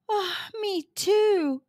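slow, high sighing voice; dejected sigh. A narrator's reading. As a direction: sigh the words out slowly. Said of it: "sighed Noah".

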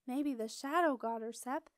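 usual speaking voice

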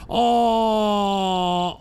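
drawn-out wailing cry; anguished cry. Real, not acted. Streamer reacting to news headline, anguished wail. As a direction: wail it in despair.

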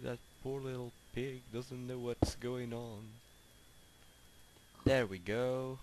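normal talking voice

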